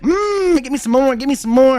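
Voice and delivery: high, loud greedy begging; hammed-up hungry craving; mock greedy craving. Mocking lefties craving more.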